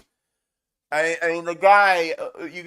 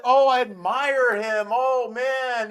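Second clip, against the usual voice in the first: mocking; 7 semitones higher, 3 dB louder, slower than usual. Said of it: "you don't say that".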